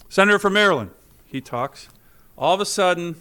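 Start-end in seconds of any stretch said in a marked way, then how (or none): 0.1-0.9 loud, higher formal call from the chair; mock official recognition, projected loudly; presiding officer's announcement. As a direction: announce it to the whole chamber. Presiding officer repeats prompt out loud.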